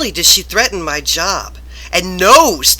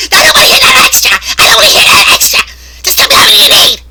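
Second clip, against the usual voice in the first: shrieking fast yell, very loud; high fast shout at a worker; boss screaming; 12 semitones higher, 11 dB louder, much faster than usual. Retold or imitated. Boss screaming again in story.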